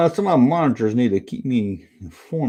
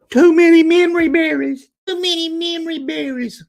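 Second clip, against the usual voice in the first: high mock voice; 13 semitones higher than usual. Quoting a show line.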